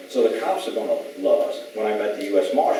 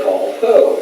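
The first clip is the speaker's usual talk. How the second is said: high, loud startled question; a baffled ranger caught off guard; surprised; 12 semitones higher, 7 dB louder than usual. Retold or imitated. A ranger hearing a strange name, surprised.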